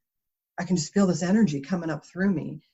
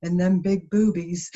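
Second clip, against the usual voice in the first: slow, lightly voiced monotone brother; deadpan slow compliment in John's voice; 4 dB louder than usual. Teller voicing brother John at the grocery line, slow deadpan. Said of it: "he's got that monotone voice".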